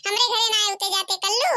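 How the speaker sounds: ordinary delivery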